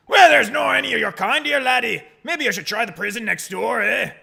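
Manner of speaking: loud, hostile governor in fake Scottish; Barry Caden. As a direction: shout them off in hammed-up Scottish. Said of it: "I'm the governor, Barry Caden".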